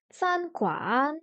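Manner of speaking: "San" held level, "Guan" dipping then rising; first then third tone. Shown as a demonstration. Learner practising the word for restaurant, first then third tone. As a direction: hold "San" steady, dip and lift "Guan". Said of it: "premier ton et troisième ton".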